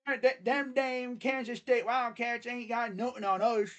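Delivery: high-pitched, badly faked Alabama twang; Alabama accent. Host mocks Bama fans trashing the Kansas State Wildcats, hammed-up Alabama accent. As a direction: crow like a boastful Bama fan in a put-on Alabama accent. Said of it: "I can't do my freaking Alabama accent".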